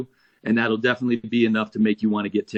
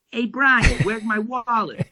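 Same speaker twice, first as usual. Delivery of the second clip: Johnny; 10 semitones higher than usual. Retold or imitated. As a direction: call out high, imitating a bandmate who's lost his things. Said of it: "and he goes".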